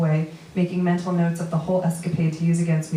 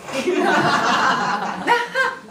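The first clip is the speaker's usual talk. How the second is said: laughing; 10 semitones higher than usual. Real, not acted. Laughing at Eric's advice.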